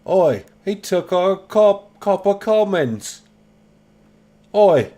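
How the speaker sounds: high loud slow mock shout of oi. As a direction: bellow oi like a thug. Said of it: "You know they said oi".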